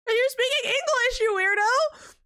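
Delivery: high-pitched, indignant retold question; mock incredulous retort. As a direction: throw it back in high-pitched disbelief. Retold rude retort to a stranger.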